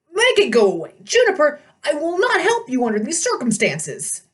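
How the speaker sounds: put-on high, indignant old satyr voice; outraged old goat-man shouting; fussy old satyr. A narrator's reading.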